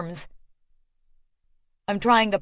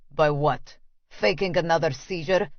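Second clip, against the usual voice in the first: sarcastic Russian man; 3 semitones lower than usual. Acted. Quarrel, his low voice.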